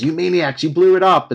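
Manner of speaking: hammed-up accusing outburst; mock outcry. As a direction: cry it out like a movie quote. Mock anguished movie quote.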